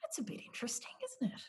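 hushed whisper. Retold or imitated. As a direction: whisper it like a passerby. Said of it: "People are gonna be like".

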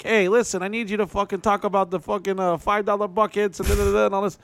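high, bossy little older woman, with a hint of an accent; Italian little lady. Older Italian promoter bossing the comic, high and pushy. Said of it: "uh, Italian little short lady".

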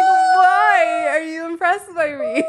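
flustered, laughing surprise; laughing disbelief. Real, not acted. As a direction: giggle through the question.

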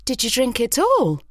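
high-pitched, gasped question; alarmed gasp. A narrator's reading. Mother finding the empty medicine bottle, gasping in alarm. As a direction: ask it aghast, pitch jumping up. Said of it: "I gasp".

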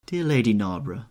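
ordinary delivery, nothing marked

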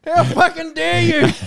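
high loud slow outraged mock; mock outrage. Joining the housemate's yell at the salesman, high mock outrage. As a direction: bellow it in fake indignation. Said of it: "joins the mock yell".

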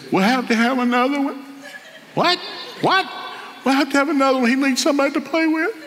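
high, wailing imitation of crying; mock crying. Wife crying after delivering their son, mock crying. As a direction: blubber it in a put-on high voice. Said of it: "And she started crying".

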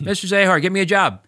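pestering demand. Retold or imitated. Student pestering teacher for a job, nagging. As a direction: keep badgering him for a job. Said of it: "I'd go".

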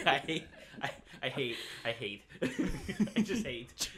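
words breaking up with laughter; helpless laughter. Real, not acted. Player cracking up at own voice, laughing through the words. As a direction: laugh so hard the sentence keeps restarting.

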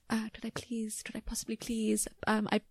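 whispered, meek begging; grovelling for a pay rise; timid pleading. Retold or imitated. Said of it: "would always be like".

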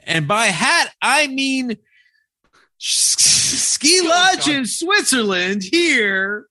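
high, slow comic line delivery; hammed-up Megan Mullally line. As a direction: stretch it out for the laugh. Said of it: "That was amazing".

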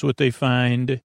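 ordinary talk, nothing marked

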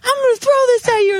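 very high, strained threat; re-enacted furious yell, high-pitched; retold mad threat. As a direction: threaten across the street, pitch through the roof. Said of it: "I was so mad".

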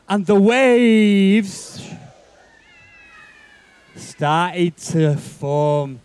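drawn out, slow suspense; slow storytelling. A narrator's reading. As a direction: stretch each word for tension.

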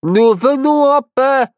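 high, slow yelled cry; shouting. A narrator's reading. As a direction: call out loud and slow. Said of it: "et le secoua en criant".

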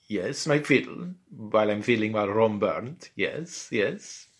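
put-on accented emperor, rising impatient tune; Emperor Nero. Roman emperor answering about his fiddle, accented impatient voice. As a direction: play along as a distracted foreign emperor. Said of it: "It was Emperor Nero".

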